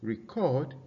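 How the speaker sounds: reCORD, the verb, weight on the second syllable; stress on second syllable. Shown as a demonstration. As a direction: keep "re" light and stress "CORD" in "Record". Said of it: "is a verb".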